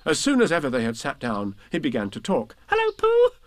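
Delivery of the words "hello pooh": very high, loud squeak; squeaky Roo. A narrator's reading. Little Roo greeting Pooh, high excited squeak. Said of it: "he squeaked".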